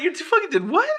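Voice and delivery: high, laughing disbelief; mock outrage at the brag; incredulous. Listener reacting to a bragging one-upper, incredulous laugh. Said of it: "You're like".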